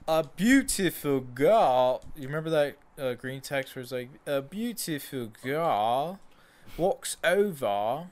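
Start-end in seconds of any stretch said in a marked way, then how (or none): 0.1-2.0 put-on British pundit accent. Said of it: "I used to be able to do his accent". 4.3-8.1 put-on slow, drawn-out British accent; British pundit accent. Friend reading the green text in the British pundit's accent. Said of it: "I used to be able to do his accent".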